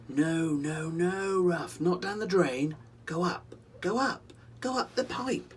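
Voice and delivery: high, loud coaxing call; urgent calling out. Acted. Rollo calling Ruff away from the drain, high and urgent. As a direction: shoo him upward, urgently.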